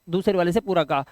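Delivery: usual speaking voice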